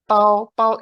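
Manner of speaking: pao held high and loud, slow; Mandarin tone on pao. Shown as a demonstration. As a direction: stretch out pao in a high level tone. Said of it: "di bahasa Mandarin".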